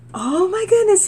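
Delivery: high, loud amazed cry; retelling her shock that prayer was answered; amazed exclaim. Retold or imitated. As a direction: squeal it out in delight.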